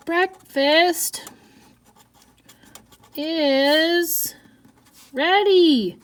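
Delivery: high, drawn-out calling voice; slow called out. Shown as a demonstration. Teacher calling breakfast while writing it out, high and drawn out. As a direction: call it slowly word by word. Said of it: "You notice how my voice changes when things have quotations".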